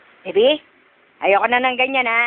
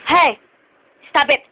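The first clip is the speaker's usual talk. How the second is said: short, firm reprimand; sharp scolding; 3 dB louder than usual. Real, not acted. Parent tells baby to stop, sharp scolding.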